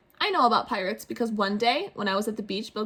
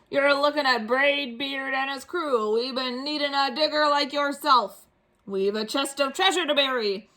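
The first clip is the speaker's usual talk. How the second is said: pirate captain; 4 semitones higher than usual. Acted. Braid Beard introduces himself.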